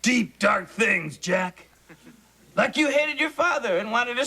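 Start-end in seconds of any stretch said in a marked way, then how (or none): 0.0-1.6 lower, slow and mysterious; slow, low mock-sinister hint; ominous tease. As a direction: drag it out like a dark secret.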